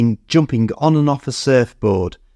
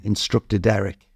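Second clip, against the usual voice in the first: usual speaking voice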